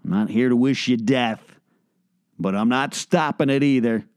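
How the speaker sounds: ordinary talk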